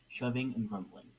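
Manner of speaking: plain reading voice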